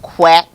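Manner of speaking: one loud, short quack; duck quack. Acted. Duck with its sound restored, loud quack. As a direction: quack it out smartly. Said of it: "went the duck".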